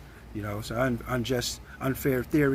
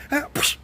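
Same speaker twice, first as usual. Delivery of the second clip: punch noises; 11 semitones higher than usual. Retold or imitated. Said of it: "I had to go".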